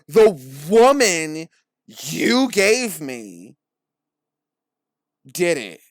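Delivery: loud, slow, exaggerated emphasis; mock blaming. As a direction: drag out the accusation in mockery. Mocks Adam blaming the woman.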